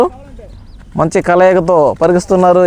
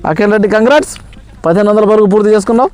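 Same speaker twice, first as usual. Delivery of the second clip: ordinary talk, nothing marked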